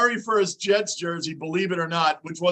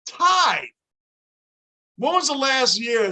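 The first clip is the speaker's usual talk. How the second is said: can't believe a game tied; a one-word gasp of disbelief; stunned disbelief; 6 semitones higher, slower than usual. Real, not acted.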